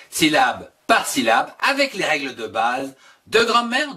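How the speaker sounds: loud, bright announcer intro; channel intro read. Acted. Teacher opening his video series with a loud announcer read. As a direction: welcome the viewers like a show opener.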